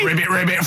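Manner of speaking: croaked ribbit, ribbit; cartoon frog croaking. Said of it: "Froggy says".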